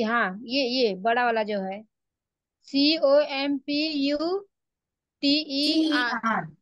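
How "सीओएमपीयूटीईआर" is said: spelling letter by letter. Real, not acted. Student spells the word out.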